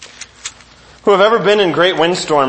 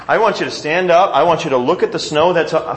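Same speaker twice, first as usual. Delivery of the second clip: ordinary talk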